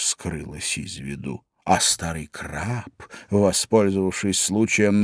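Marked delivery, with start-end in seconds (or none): none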